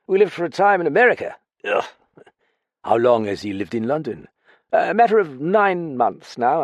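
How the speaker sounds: lightly voiced boozy ex-officer; drunk lieutenant. Drunk Graffin answering.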